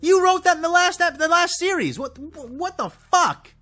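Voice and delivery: high, loud, incredulous rant; exasperated outburst. Real, not acted. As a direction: fan venting real fury at the writers, high and loud. Ranting about Beskar contradictions.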